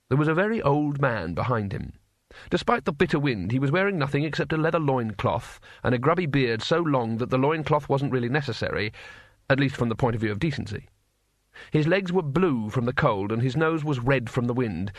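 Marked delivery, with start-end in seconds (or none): none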